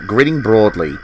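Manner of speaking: usual speaking voice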